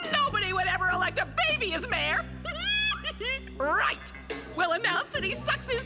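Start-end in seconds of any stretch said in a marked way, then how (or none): 2.3-3.5 evil laugh. Acted. Villains delight in scheme.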